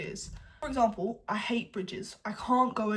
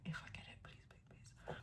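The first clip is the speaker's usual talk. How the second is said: barely audible whispered wish; hushed, pleading whisper; whispered plea; 20 dB quieter, much faster than usual. Real, not acted. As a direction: whisper it like a private little prayer. Hushed wishing aside to self.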